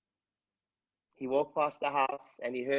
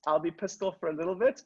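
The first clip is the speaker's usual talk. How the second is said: normal talking voice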